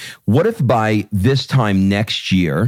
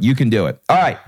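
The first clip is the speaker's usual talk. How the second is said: ordinary talk, nothing marked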